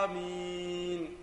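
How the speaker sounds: آمين with the first vowel stretched very long; overlong amin, wrong. Shown as a demonstration. A teacher mocking worshippers who overstretch amin. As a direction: drag out the آ of آمين far too long. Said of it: "بعض الناس ... يقول".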